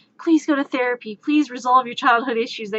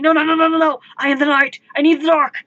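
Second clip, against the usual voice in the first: loud, high hammed-up hero wail; panicked protest; 6 semitones higher, 9 dB louder, faster than usual. Brooding hero stopping partner's light switch, panicked shouting. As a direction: shout in melodramatic alarm.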